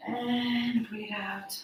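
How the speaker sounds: slow, soft breathy exhale; slow deep breaths. Shown as a demonstration. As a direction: let the breath carry the words. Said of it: "Let's do one more time".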